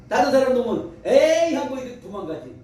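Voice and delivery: usual speaking voice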